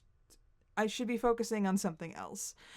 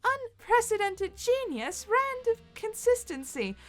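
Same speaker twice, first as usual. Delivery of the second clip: grandiose queen; 6 semitones higher than usual. Acted. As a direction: gloat over your own cleverness. Queen's boastful monologue.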